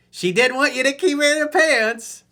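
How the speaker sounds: high, loud sarcastic jab; mocking exaggerated retort. Coach mocking.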